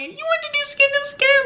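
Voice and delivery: very high, silly husband voice; husband imitation. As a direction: mimic the oblivious husband in a squeak.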